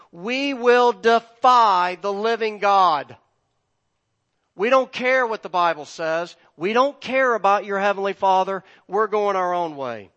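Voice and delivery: very loud, high-pitched defiance; defiant shout. Acted. Preacher as the world's powerful rejecting God, defiant shouting. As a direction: hurl it out as a rebellious challenge to God. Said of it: "the nations and the powerful of this world are saying".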